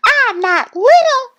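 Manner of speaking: very high, loud indignant protest; hollering. A narrator's reading. Thistle objecting to Goose, high loud holler. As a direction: holler it high like an offended duckling. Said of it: "Thistle hollered".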